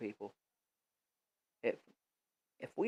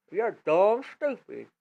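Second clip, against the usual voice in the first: mocking insult; slower than usual. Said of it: "you call me dumb stupid".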